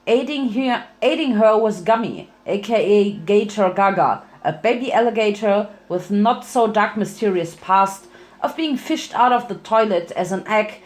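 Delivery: ordinary delivery, nothing marked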